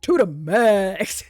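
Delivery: imitating the show's tagline. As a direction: stretch it out like a dramatic show tagline. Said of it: "every single one is".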